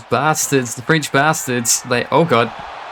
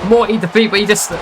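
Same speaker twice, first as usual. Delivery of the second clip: excited shout; 6 semitones higher, 3 dB louder, faster than usual. Real, not acted. Excited reaction to a botch.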